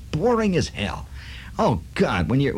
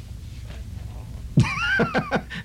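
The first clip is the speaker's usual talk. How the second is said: wheezing laugh; 5 dB quieter than usual. Real, not acted. Laugh after the punchline.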